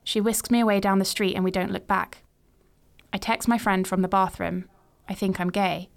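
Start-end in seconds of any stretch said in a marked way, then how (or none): none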